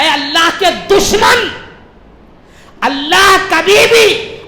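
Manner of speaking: thundering rebuke, high and loud; full-force preaching. Acted. Caliph rebukes the thief who claimed a first offence, thundering preaching. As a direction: hurl it out like a fiery preacher.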